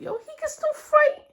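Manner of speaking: believe in your guy out loud; hyped. Real, not acted. Fan insisting his hero isn't beaten, high and excited. Hyping up Luffy's comeback.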